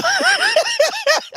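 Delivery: squealing burst of laughter; high-pitched laughter. Real, not acted. Dad cracking up at his daughter's reply, high laughter.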